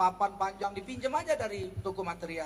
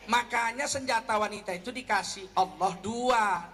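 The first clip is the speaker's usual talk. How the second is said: ordinary delivery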